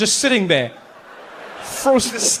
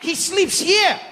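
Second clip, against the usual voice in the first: much higher, shouting guide welcome; overeager tour guide; 11 semitones higher than usual. Retold or imitated. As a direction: greet them like a way too happy guide. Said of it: "he's like".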